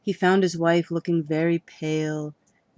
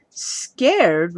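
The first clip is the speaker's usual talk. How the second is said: indignant echo; 6 dB louder than usual. Acted. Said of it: "repeated Mister Crow".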